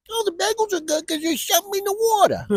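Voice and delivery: high-pitched mock New Yorker; New York accent. Comic quoting New Yorkers on bagel water, high New York accent. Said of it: "how people say in New York".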